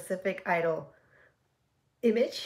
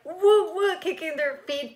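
high, loud delighted woo; excited cheering; 8 semitones higher, 6 dB louder than usual. Real, not acted. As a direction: cheer along with glee. Cheering at dance video.

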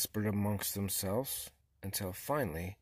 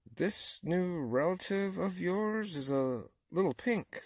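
raccoon; 8 semitones higher than usual. A narrator's reading. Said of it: "said the raccoon".

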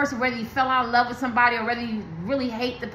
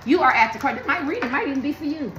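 ordinary delivery

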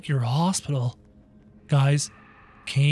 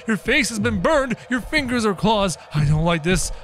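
normal talking voice

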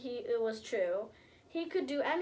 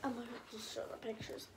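much softer and quick; soft fast aside; hushed aside; 11 dB quieter, much faster than usual. Real, not acted. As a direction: mutter the note to the camera.

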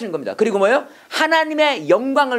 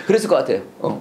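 normal talking voice

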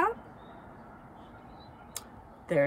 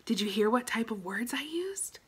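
whispered question to the class; hushed, secretive classroom whisper; 5 dB quieter than usual. Acted. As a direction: lean in and whisper it. Teacher whispers to children.

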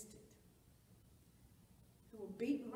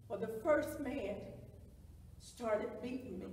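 ordinary talk, nothing marked

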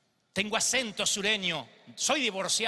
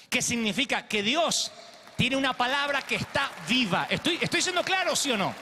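emphatic preaching; 4 semitones higher than usual. Acted. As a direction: drive the point home to the crowd. Preacher presses the congregation. (predicación enfática e insistente)

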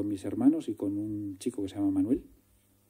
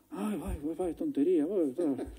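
mocking sister; 7 semitones higher, 5 dB louder than usual. Sister mocking brother's film idea.

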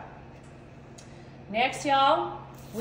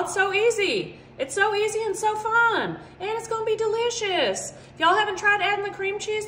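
gushing; 7 semitones higher than usual. Real, not acted. Gushes about the easy recipe.

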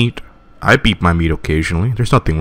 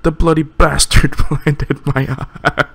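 higher, slow, breaking into laughter; laughing through the line; 9 semitones higher than usual. Real, not acted. Villain losing his eye, laughter breaking in. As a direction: try to stay in character but crack up. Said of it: "I shouldn't be laughing".

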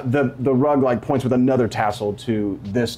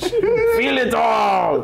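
villain gloat; 12 semitones higher, 3 dB louder, much slower than usual. Acted.

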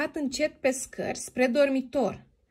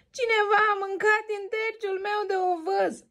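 higher little bear cub voice; baby bear; 10 semitones higher than usual. Acted. Baby bear complains his porridge was eaten, high cub voice. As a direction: play the small cub, up high. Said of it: "strigă cu vocea scăzută copilul".

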